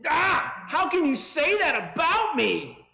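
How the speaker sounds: mock indignant yell, pitched far up; outraged outburst. Confronted parent snaps back at the accuser, high outraged yell. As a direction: explode in a high, loud, hammed-up protest.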